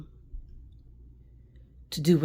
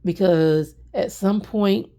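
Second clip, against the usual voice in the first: rising emotion; 3 semitones higher, 4 dB louder than usual. Real, not acted. Emotional self-affirmation.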